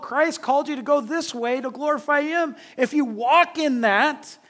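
high, loud, driving sermon delivery; impassioned preaching. Real, not acted. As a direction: preach it with rising force. Preaches at full force.

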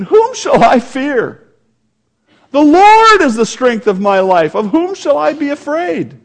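fervent preacher lifting the scripture; preaching at full force. Acted. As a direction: proclaim it to the whole hall.

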